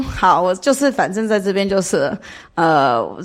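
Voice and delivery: ordinary talk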